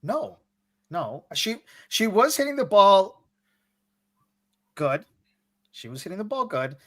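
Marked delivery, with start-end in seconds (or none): none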